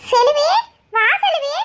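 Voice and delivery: loud, lifted call of her name; calling out a welcome. Acted. Mother greets Selvi at the door, loud call.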